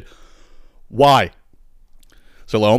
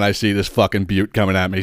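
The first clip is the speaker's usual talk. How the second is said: normal talking voice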